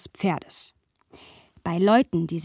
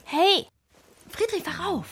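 high, urgent calls to a sleeper; calling to wake him; 9 semitones higher, slower than usual. Acted. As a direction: call him awake, pitch up. Weckt den eingenickten Friedrich. (lautes Wachrufen)

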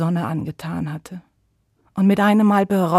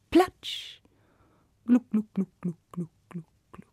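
splash and gluck sounds; 6 dB quieter than usual. Acted. Narrator voicing sun's box sinking, fading. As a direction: let the glucks sink away.

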